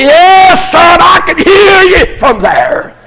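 high, loud, drawn-out reassuring shout; calling out. Retold or imitated. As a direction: holler it down to a child far off. Grandfather calls down to dark basement.

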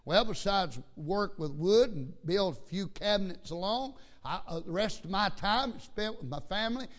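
loud, high pulpit voice; forceful preaching. Real, not acted. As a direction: drive it home to the congregation. Preaches with raised voice.

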